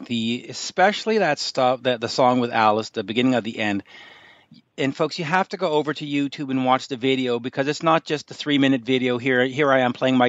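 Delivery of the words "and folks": ordinary talk, nothing marked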